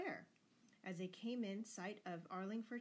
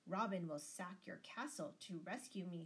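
ordinary delivery, nothing marked

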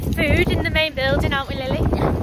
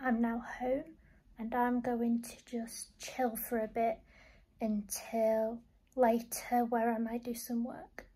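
whispering; 11 dB quieter than usual. Real, not acted. Unvoiced whisper.

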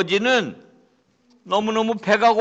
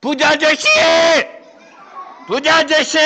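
calling louder; 10 semitones higher, 4 dB louder than usual. Acted. Beggar calling again because nobody heard. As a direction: call up to the house again.

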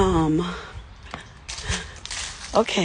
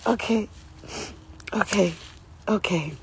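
ordinary delivery

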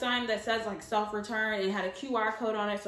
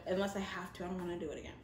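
much softer, trailing repeat; hushed repeat; 5 semitones lower, 9 dB quieter, much faster than usual. Acted. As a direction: let it fade to almost nothing.

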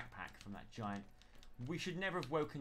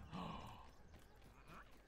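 soft gasp; 4 semitones higher, 10 dB quieter than usual. Real, not acted. Spots fresh water.

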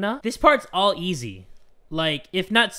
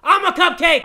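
loud, higher outcry; dismayed shout; 9 semitones higher, 10 dB louder than usual. Real, not acted. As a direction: yell in disbelief at the mistake. Accidentally ate cupcake.

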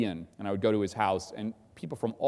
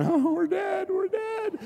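mock wail, far higher; mock panic; slower than usual. Mocking the frozen crew in the Jeep, hammed-up panicked wail. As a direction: whimper it like a doomed coward, hammed-up. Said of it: "kinda sitting there going".

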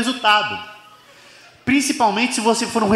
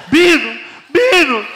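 high, loud calls for a friend; imitated shouting; 8 semitones higher, 4 dB louder, much slower than usual. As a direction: yell the name like you urgently need him. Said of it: "Ricardo tava gritando".

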